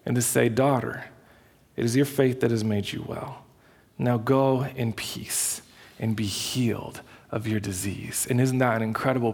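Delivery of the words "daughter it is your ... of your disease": gentle, hushed fatherly reassurance; tender, intimate. Retold or imitated. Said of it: "very intimately, very intimately, and very tenderly".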